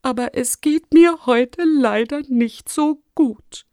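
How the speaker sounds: high, feeble, suffering tone; weak voice. A narrator's reading. Ailing rector excusing himself to the children, weakly. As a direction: plead poorly, as if worn out. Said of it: "mit schwacher Stimme".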